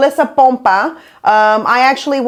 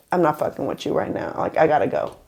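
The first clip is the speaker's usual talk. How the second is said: much softer, quick, lower dismissive line; quiet brush-off; 6 semitones lower, 8 dB quieter, faster than usual. Retold or imitated. Jessica coldly dismissing the front-desk girl, quiet and fast. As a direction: say it under your breath, done with her.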